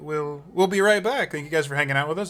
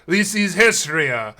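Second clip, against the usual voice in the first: louder, higher and drawn out; proud introduction; 4 semitones higher, 6 dB louder than usual. Acted. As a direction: announce a party member with fanfare. Introducing party members in character.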